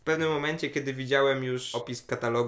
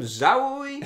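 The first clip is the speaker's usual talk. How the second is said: high, playful "Żałuj!" thrown at a friend; teasing exclamation; 11 semitones higher, 6 dB louder than usual. Retold or imitated. Teasing his co-host for missing the film, a playful high exclamation. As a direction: tease him that he missed out. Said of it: "Powiedziałbym".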